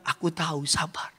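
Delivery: low, soft and patient; God's calm reply. Acted. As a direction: quietly soothe the complainer. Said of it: "Tuhan bilang". (suara Tuhan yang sabar, rendah dan pelan)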